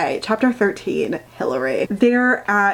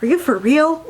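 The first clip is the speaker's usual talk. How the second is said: incredulous; 4 semitones higher than usual. Retold or imitated. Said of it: "I was like".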